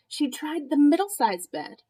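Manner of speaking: plain reading voice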